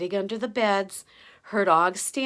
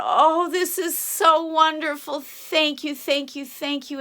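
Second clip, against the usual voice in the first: grateful call; 5 semitones higher, 6 dB louder, slower than usual. A narrator's reading. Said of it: "he yelled out".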